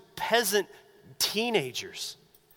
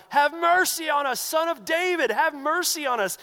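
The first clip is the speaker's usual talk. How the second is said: much higher, louder desperate cry; yell even louder; 8 semitones higher, 4 dB louder than usual. Retold or imitated. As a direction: shout over everyone, begging. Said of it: "yell even louder".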